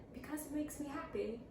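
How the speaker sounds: sweetly imitated British accent. Student explaining to the teacher, mock British accent. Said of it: "Why are you talking like that?".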